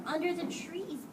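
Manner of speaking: ordinary delivery, nothing marked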